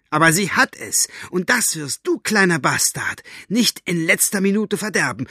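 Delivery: high, defiant stand; determined. A narrator's reading. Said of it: "Mit entschlossener Miene".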